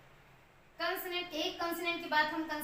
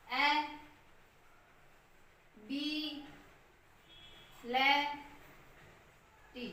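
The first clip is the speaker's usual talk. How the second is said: "ए, बी, ले, टी" said as separate syllables with long pauses; 4 dB quieter, much slower than usual. Shown as a demonstration. Teacher breaking ability into syllables for students, slow and separate. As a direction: sound out "ले" and "टी" slowly, one by one. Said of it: "ये हो गया अभी लटी".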